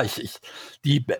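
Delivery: ordinary delivery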